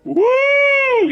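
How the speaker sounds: high, loud falsetto whoop; stadium whoop. Acted. Fan in a football stadium, falsetto whoop. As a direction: let out one high, loud cheer. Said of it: "in a football stadium".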